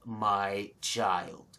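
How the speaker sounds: slow, low, soft dragon plea; grave dragon murmur. Acted. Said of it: "And this bellows out".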